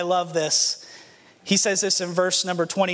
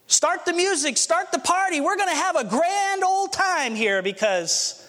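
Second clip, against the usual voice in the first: celebrating father; 8 semitones higher than usual. Acted. Father launches the party.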